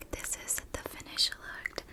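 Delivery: normal talking voice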